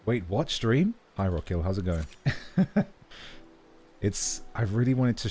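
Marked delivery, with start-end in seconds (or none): none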